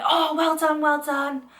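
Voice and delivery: gushing teacher. Retold or imitated. Imitating her French teacher gushing congratulations. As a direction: gush like a delighted teacher. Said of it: "was like".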